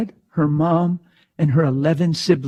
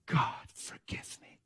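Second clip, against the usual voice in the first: slow, breathless whisper; whispered prayer; 17 dB quieter, slower than usual. Retold or imitated. Girl praying for forgiveness in a slow whisper. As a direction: whisper it like a broken confession.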